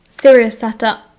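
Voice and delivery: ordinary delivery, nothing marked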